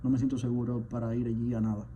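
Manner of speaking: ordinary delivery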